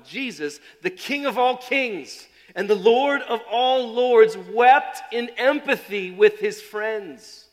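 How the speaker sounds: loud, slow, exalted; solemn proclamation. Acted. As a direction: proclaim his majesty slowly. Emphatic proclamation.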